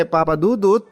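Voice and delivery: usual speaking voice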